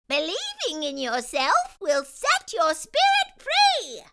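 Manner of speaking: a magical fairy giving her grand lesson. Acted. Fairy telling the birthday girl what beauty is, high proclaiming voice. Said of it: "The fairy said".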